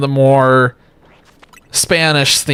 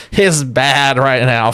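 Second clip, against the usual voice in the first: normal talking voice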